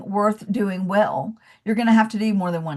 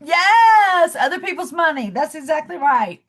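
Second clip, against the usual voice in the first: excited praise; 7 semitones higher, 4 dB louder than usual. Real, not acted. Student answers correctly.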